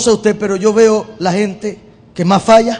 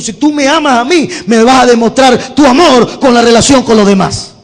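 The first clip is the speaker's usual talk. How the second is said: rising fiery preaching; preaching climax; 2 semitones higher, 5 dB louder than usual. Real, not acted. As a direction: drive it home with full force. Preaching builds to full force.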